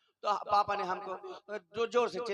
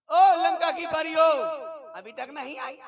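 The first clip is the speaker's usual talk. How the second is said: theatrical call; 7 semitones higher, 8 dB louder than usual. Acted. Calling out to Lanka's fairies.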